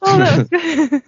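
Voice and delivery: high and delighted. Real, not acted. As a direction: gush with delight. Delighted reaction.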